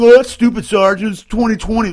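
playing a snide young soldier; mock scoffing soldier. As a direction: imitate a soldier brushing off the rules. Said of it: "And so people think".

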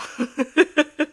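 laughing. Real, not acted. Laughs at own remark.

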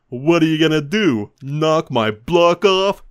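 swaggering biker sneering at a clown, slow and loud; mocking tough guy. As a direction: dare the little clown, drawn out. Big biker taunting clown.

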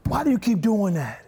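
a father's irritated demand; frustrated dad. Retold or imitated. Dad to son, mildly exasperated. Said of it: "in a healthy way".

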